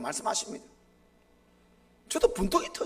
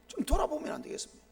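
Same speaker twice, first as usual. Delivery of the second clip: pleading; 9 semitones higher, 3 dB quieter, faster than usual. Retold or imitated. Pastor pleading to late father's photo.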